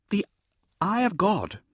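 high, mildly nervous answer; nervous junior. A narrator's reading. Junior steps in to help out, nervous and high. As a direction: venture the answer with a touch of nerves. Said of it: "said the female junior nervously".

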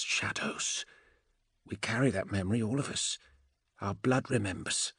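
witch's voice dropping toward a whisper; hushed, eerie seer; Andromeda, hushed and ominous. Acted. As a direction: let the memory sink in, quietly.

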